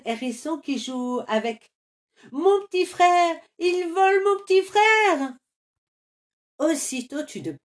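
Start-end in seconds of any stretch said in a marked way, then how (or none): 2.3-5.3 high, loud cry of alarm. Acted. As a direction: shout it out as the egg is stolen. Carmelito voit voler l'œuf. (crie, affolé, qu'on vole son petit frère)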